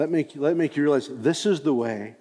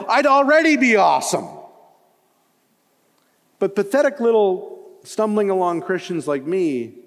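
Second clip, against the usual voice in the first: mock the excuse in a pleading, higher voice; a complaining believer voiced higher and louder; imagined self-doubting believer; 8 semitones higher, 4 dB louder than usual. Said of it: "you might say".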